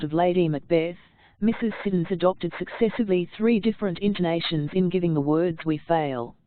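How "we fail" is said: quick scornful "we fail?" asked in disbelief; contemptuous question. Shown as a demonstration. Teacher demonstrating Lady Macbeth's scornful question. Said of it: "a quick contemptuous interrogation".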